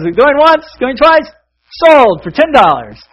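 loud, high closing call, drawn out at the end; auctioneer. Acted. Auctioneer closes the sale to the gardener, loud calling. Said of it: "So he goes".

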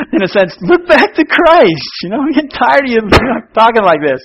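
loud, high, fast, laughing through it; wife laughing while telling him off; fed-up wife, laughing. Retold or imitated. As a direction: scold him but crack up. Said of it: "May got fed up with me".

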